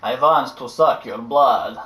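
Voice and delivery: low slow vampire voice; Dracula voice. Pretend. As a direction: drawl it like a vampire. Dracula line.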